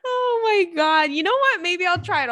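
high, loud words squeezed through laughter; laughing hard. Real, not acted. Host tickled by her own daydream, laughing hard. Cracking up at own imagined story.

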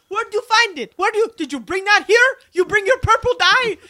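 high, loud, fast shocked mother; outraged mom voice. Retold or imitated. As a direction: fire questions like a shocked mom.

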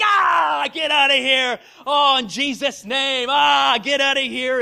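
high, loud panicked shouting; mock panic. Bystanders seeing blood through the window, in mock panic. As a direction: yell it in hammed-up terror, drawing out the cries.

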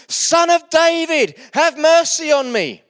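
loud, high, slow desperate cry. A narrator's reading. Beggar ignoring the crowd shouting to Jesus, loud pleading cry. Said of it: "But he shouted all the more".

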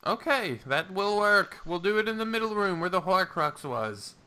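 put-on high old wizard voice; Danforth voice. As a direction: raise the pitch for the mage.

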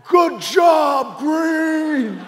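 loud, high teammate's shout; excited bellow. Acted. Huge left tackle lifting his teammate, excited bellow. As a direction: bellow praise as you hoist him up. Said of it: "Tuck was so excited".

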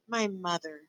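soft, subdued sadness; sad. A narrator's reading. Princess reminded of her mother, sad. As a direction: say it softly and sadly. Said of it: "said the pea sadly".

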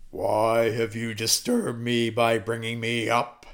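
low, slow ghostly voice; Samuel's spirit. Acted. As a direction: intone it like a prophet roused from the dead. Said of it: "Samuel said to Saul".

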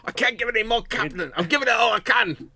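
high, loud, bad Scottish accent; hammed-up Scottish engineer pleading; Scotty. Enterprise engineer to his captain, bad Scottish accent. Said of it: "He's like Scotty in the Enterprise".

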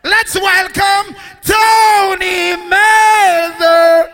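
very slow, high announcer shout; slow announcer call. Real, not acted. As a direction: bring the next act on stage, drawn right out. MC introducing next sound.

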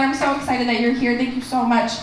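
ordinary talk, nothing marked

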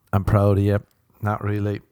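usual speaking voice

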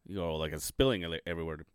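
tipsy, slightly slurred speech. Real, not acted. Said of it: "I think I'm getting drunk".

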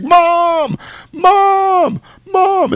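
high, loud cries for help; panicked screaming. Retold or imitated. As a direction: imitate a trapped girl yelling. Voices Nancy screaming from the car.

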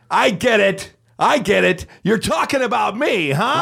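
imitating an indignant man, high-pitched; offended outburst. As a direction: act out an affronted heckler, voice pushed way up. Offended salesman confronts the performers.

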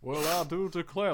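a hint of a blustery cartoon rooster; Foghorn Leghorn. Shown as a demonstration. Co-host showing how the cartoon rooster sounds, light impression. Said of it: "what does Foghorn Leghorn sound like?".

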